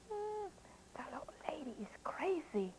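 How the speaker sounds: very soft, whispery aside; muttered bear aside. Acted. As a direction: mutter it under your breath. Bear mutters about the witch.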